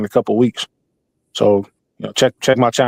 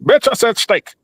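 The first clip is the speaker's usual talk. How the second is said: higher Obama voice snapping about a wrong order; Obama cussing out; 7 semitones higher, slower than usual. Retold or imitated. Comedian voicing Obama scolding a waiter, a mild mock rant. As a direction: play a president losing his cool at a restaurant. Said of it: "imagine him in a regular setting".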